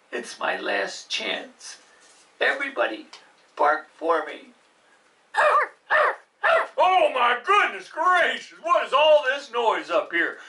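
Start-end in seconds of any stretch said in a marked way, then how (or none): 0.1-1.8 low unvoiced whisper, slow; whispered aside. 6.8-10.4 mock surprise. Dentist bursts in surprised.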